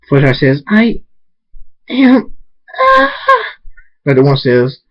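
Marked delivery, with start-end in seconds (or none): 0.7-3.1 high, broken-up effort rising to a scream; pained words breaking into a shriek; straining in labor. Acted. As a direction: force it out between pushes.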